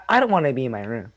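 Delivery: ordinary talk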